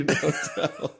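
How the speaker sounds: ordinary delivery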